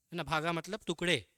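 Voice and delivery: ordinary talk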